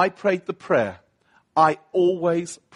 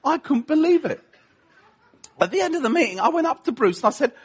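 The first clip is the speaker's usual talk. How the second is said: ordinary talk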